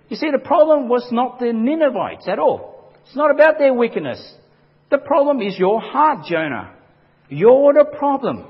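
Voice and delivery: loud, high, pressing preacher's voice, slowing on "You're the problem"; full-force preaching. Acted. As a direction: drive the point home at Jonah.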